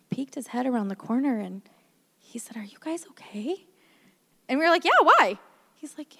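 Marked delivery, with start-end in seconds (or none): 2.6-3.7 hushed, tentative near-whisper; cautious check. Retold or imitated. Wife voicing husband peeking round the corner, hushed and cautious. 4.8-5.4 loud, high, mock-innocent answer; puzzled reply. Wife retelling her and mom's answer to husband, loudly puzzled.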